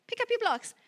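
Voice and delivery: high, chirpy mom giving an order; a cheerful mother telling a toddler to tidy up; mock mom command. Mom to child, asking for the blocks, lightly voiced. Plain contrast take.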